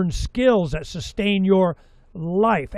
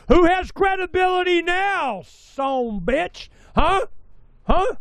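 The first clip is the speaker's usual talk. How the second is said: much higher, mocking I-told-you-so; gloating taunt; 12 semitones higher, slower than usual. Real, not acted. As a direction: rub it in their faces. Taunting doubters about silver.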